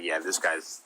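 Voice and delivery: normal talking voice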